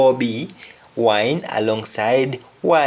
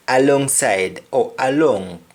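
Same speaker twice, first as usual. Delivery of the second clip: ordinary talk, nothing marked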